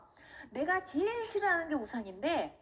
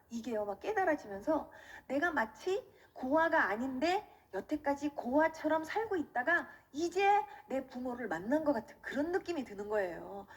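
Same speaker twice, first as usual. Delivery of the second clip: normal talking voice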